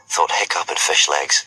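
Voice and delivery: plain reading voice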